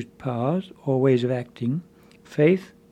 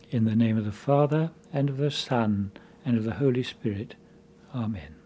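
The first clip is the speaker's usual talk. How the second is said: soft, measured opening prayer; 3 semitones lower, 3 dB quieter than usual. Acted. Priest blessing the congregation, soft prayer tone. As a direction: intone the blessing quietly.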